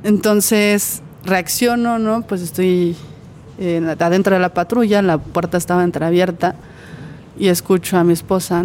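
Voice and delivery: ordinary talk, nothing marked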